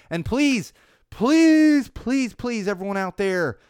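please rising high and loud; mock desperate pleading; begging. Real, not acted. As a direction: plead with listeners, over the top. Begging for Indiegogo support.

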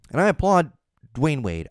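normal talking voice

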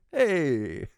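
put-on high, drawn-out Italian-accented call; mock Italian accent. Host mocking his own accent slip, Italian accent. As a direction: ham up the Italian accent on one long "hey". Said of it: "I don't know why I had an Italian accent".

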